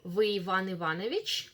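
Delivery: "Вы Иван Иванович?" rising sharply at the end; general question intonation. Shown as a demonstration. Teacher models a Russian general question, rising intonation. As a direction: ask "Вы Иван Иванович?" with the final word lifting. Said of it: "Mind the intonation, general question".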